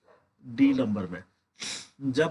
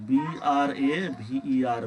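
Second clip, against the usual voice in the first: slow spelling; much slower than usual. Shown as a demonstration. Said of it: "teacher spells word slowly".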